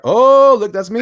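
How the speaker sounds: high, loud burst of excitement; delighted discovery. Real, not acted. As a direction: cry out on spotting yourself. Spots own cover in book.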